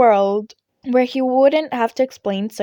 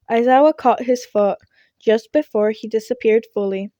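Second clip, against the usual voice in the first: ordinary delivery, nothing marked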